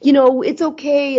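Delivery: put-on high hurried soothing to a crying child; mother rushing to stop her daughter's tears. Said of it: "The pain for me was so overwhelming".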